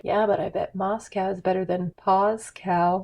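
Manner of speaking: soft muttered dad joke; imitating Dad's quiet deadpan aside; under his breath. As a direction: slip the joke out to yourself. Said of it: "Dad under his breath was like".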